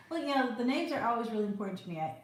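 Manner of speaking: normal talking voice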